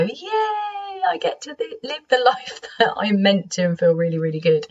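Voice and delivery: high, bright burst of mock glee; excited yay. Imagined relief once free.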